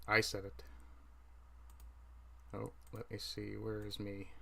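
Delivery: usual speaking voice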